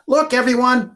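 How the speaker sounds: high, loud excited call; boy calling to his family, high and loud. Acted. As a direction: call the crowd over like a thrilled kid. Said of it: "Ben says".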